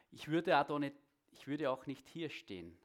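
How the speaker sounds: ordinary talk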